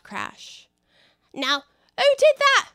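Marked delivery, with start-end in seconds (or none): 1.3-2.7 high, loud and cross; indignant demand. Acted. Rabbit demanding who caused the crash, high and indignant. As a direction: demand to know who's to blame.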